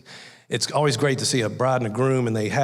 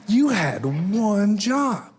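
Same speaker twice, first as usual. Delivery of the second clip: high, very drawn-out mock reproach; groom's mother scolding; 7 semitones higher, much slower than usual. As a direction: stretch every word in a high motherly scolding. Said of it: "the mom of the groom's kinda going".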